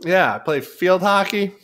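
hammed-up sorority girl talking sport; mock sorority girl. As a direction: keep the chirpy college-girl act going. Continuing sorority girl bit.

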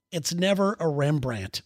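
ordinary talk, nothing marked

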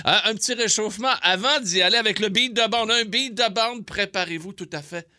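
annonce enjouée plus haute; présentation radio. Acted. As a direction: lance le segment musical. Présente la chanson. (ton d'animateur enjoué)

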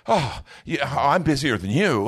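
hammed-up boast of busyness; mock one-upping. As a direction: brag to outdo the other. Busy as a badge.

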